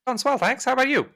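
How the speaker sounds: high-pitched switchboard operator; chirpy, high operator voice answering a caller; polite, high-voiced operator on the line; telephone operator.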